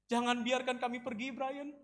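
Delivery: high, small-voiced plea; tearful pleading. Maria kisses Brian's foot, pleading tearfully. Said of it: "dengan mata yang menangis".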